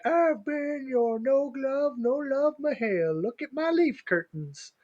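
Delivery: high, slow mock voice of Reese; imitating Reese. Co-host imitating Reese signing off, high and slow. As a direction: play the absent cohost, high and drawn out. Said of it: "she would say something like".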